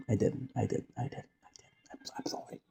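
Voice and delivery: sheepishly confess, trailing off to nothing; a mock-guilty whispered apology; fading whisper. Guilty repeated admission, fading.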